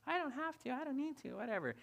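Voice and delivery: high-pitched, sassy child mimicry; put-on high sassy voice, falling away at the end; sassy little girl. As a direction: imitate a daughter's cheeky backtalk. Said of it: "It was so sassy and witty and like".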